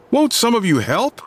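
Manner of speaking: a high, desperate plea; crying desperately. A narrator's reading. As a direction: beg the crowd for help. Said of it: "Scott cried desperately at the crowd".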